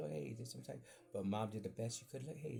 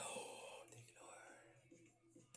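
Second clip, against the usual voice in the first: slow, hushed whisper of thanks; whispering thanks, relieved mom wasn't home; whispered relief; 15 dB quieter, much slower than usual. Retold or imitated. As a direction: breathe it out slow like a secret prayer. Retold relief, mom not home.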